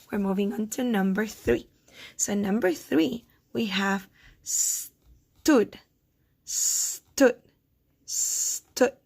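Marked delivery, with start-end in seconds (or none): 4.4-5.8 "stood" as a long "sss", then "tood"; sounded out. Shown as a demonstration. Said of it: "How many syllables do you hear". 6.4-7.4 "stood" as a long "sss", then "tood"; sounded out. Shown as a demonstration. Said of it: "How many syllables do you hear". 8.0-8.9 "stood" as a long "sss", then "tood"; sounded out. Shown as a demonstration. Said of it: "How many syllables do you hear".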